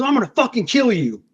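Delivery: high, furious threat; murderous threat. Retold or imitated. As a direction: spit out a death threat. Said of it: "he snapped and he said".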